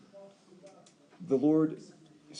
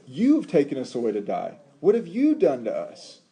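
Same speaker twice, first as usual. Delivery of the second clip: louder, accusing complaint, slowed at the end; mocking grumble; 6 dB louder than usual. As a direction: whine it like an accusation at Moses. Quoting grumbling Israelites.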